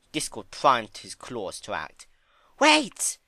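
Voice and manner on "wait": sudden high, loud cry of Wait. Acted. Fluttershy stopping Discord's claw, crying out high and loud. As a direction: stop him with a sharp cry. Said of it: "cried Fluttershy".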